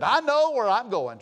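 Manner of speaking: loud, high, drawn-out declaration; emphatic retold exclamation. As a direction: imitate her declaring it loud and slow.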